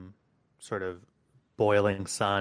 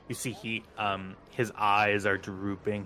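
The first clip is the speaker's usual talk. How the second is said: usual speaking voice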